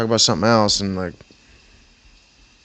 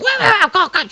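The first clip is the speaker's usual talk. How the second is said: very high, loud mock yammering; mocking wail; 5 dB louder than usual. Coworker followed out after being shoved, mock wailing. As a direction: squawk it to ridicule him.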